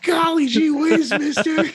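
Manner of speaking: put-on high gee-whiz boy; wholesome 1950s kid. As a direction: chirp it like an old-fashioned kid. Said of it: "Why is he talking like that?".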